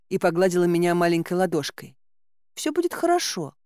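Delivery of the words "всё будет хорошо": put-on high, little-girl voice; маленькая царевна. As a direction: reassure in a high child's voice. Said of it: "сказала она". (высокий детский голосок)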